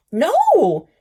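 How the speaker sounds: a high, loud no through laughter; laughing harder. Acted. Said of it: "he said, laughing even harder".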